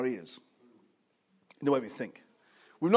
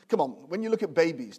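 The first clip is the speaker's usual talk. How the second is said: normal talking voice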